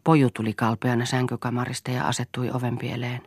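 ordinary delivery

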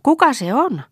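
put-on high-pitched, curious old woman's question. A narrator's reading. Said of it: "kysyi hän uteliaana". (uteliaasti, korkealla äänellä)

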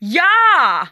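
loud, high yell; piercing agreement yell; shriek. A narrator's reading. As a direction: scream it out in one burst. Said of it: "Krista kiljaisi".

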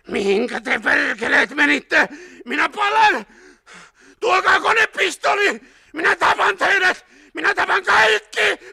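high, loud screams of rage; wild roaring. A narrator's reading. Burning man cursing those who left him, roaring wildly. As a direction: shriek curses and threats in agony. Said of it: "se muuttui villiksi karjunnaksi".

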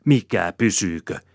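indignant comeback; flaring up. A narrator's reading. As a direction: snap back indignantly. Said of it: "kimmahtaa Rosina".

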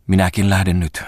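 a slight tremble, understated; slightly quivering voice. A narrator's reading. Falk announces his departure, voice quivering slightly. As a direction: let the voice waver just a little. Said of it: "sanoi hän hiukan värähtävällä äänellä".

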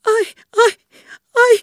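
loud, very high sobbing cry; girl bursts out crying behind the door; crying wail. A narrator's reading. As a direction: burst out wailing in tears. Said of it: "rupesi Kerttu itkien huutamaan".

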